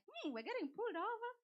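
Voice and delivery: high, mock-worried murmur; mock worried realization. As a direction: play the two of you sensing trouble. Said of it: "and we're like".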